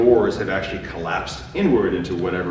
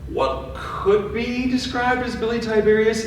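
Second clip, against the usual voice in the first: dramatic reveal; 12 semitones higher than usual. Acted. GM announcing ghost dramatically.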